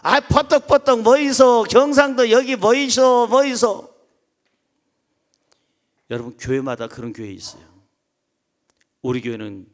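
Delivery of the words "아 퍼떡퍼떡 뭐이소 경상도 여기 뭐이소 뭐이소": loud, high Gyeongsang dialect; Gyeongsang accent. Acted. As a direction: imitate a Gyeongsang elder hollering to gather the faction. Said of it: "경상도 사람들은 또 안 빠지죠".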